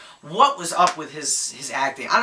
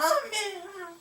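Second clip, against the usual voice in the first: a much higher, hammed-up squeal of hope; mock hopeful; 12 semitones higher than usual. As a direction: mimic naive excitement with a squeaky break. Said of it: "because I thought".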